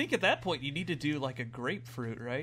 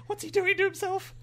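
high dog voice; 7 dB louder than usual. Pretend. Voicing the dogs' thoughts.

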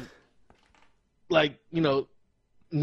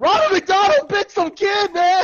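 higher, loud panicked shout; frantic mock caller; 9 dB louder than usual. Calling 911 about the clown, frantic shout. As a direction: yell at the dispatcher in mock panic.

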